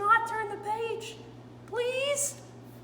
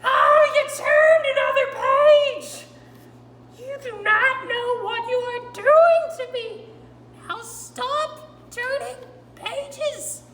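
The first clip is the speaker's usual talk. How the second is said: much louder, higher frantic protest; exasperated yell; 5 semitones higher, 14 dB louder, slower than usual. Acted. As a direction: Grover begging the reader to stop. Grover despairs at another page.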